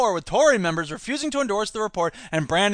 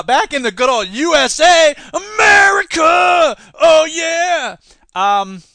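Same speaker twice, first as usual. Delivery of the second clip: much higher, louder slow cheer; mock patriotic shout; 9 semitones higher, 8 dB louder, slower than usual. Hailing America sarcastically, patriotic shout. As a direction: cheer like a jingoist.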